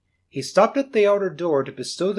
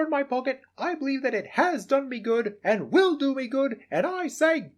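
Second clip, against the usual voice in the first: put-on raised, heartfelt young man's voice; nephew, high and earnest; 11 semitones higher than usual. Nephew defending Christmas to his uncle, earnest and high. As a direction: plead the case for Christmas warmly. Nephew's impassioned speech to Scrooge.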